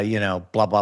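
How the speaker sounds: ordinary talk, nothing marked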